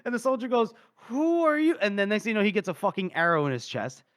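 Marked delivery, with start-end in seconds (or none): 1.0-1.8 high, sharp mock shout of alarm; startled challenge. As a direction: call out as a startled soldier. Soldier challenges intruders in dark.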